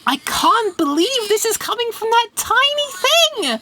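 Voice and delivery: high, thrilled disbelief; amazed. Real, not acted. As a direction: squeal it out in delight. Speaker sounds surprisingly loud.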